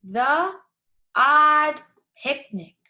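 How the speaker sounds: reading aloud together. Shown as a demonstration. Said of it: "Let's read the title together. Ready?".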